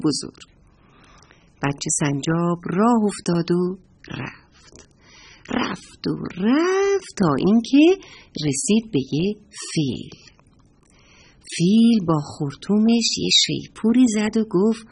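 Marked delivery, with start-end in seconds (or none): none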